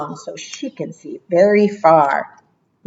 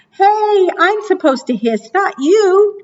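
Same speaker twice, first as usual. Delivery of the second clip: indignant snake; 12 semitones higher than usual. Acted. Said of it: "said Snake".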